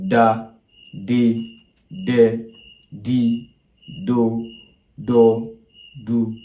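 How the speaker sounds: practicing tones: Da di de do held level at mid pitch; mid tone syllables. Shown as a demonstration. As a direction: say each syllable flat in the middle. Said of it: "Now let's go on with re".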